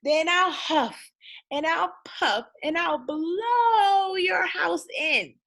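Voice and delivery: high, slow, growling wolf threat; big bad wolf. Wolf bellowing a threat at the little pig.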